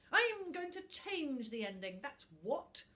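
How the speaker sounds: loud gloating creature voice; the book gloats to Tuft; villainous book. Acted. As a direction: threaten with nasty relish.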